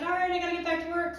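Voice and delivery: high, quick brush-off; hurried sign-off. Retold or imitated. Friend ending the call to work, hurried.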